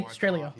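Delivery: AUSTRALIA clipped down to 'Straya; lazy Australian pronunciation. Shown as a demonstration. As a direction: drop the start of AUSTRALIA lazily. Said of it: "We say Australia".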